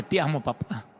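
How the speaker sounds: soft, quiet "Te amo, papá" said gently; tender murmur. Retold or imitated. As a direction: murmur "Te amo, papá" softly to a drunk father. Son softly tells drunk father.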